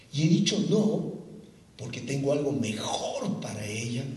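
lightly voiced God, low and soft; a hint of a calm, low divine voice; God answering the heartbroken man, low and soft. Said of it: "esa voz más o menos dijo así".

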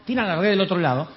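ordinary talk

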